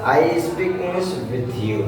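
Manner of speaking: "I speak English with you" said slowly; teacher repeating the finished sentence for students; slow model. Shown as a demonstration. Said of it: "अब इसमें भी वही कर दीजिए".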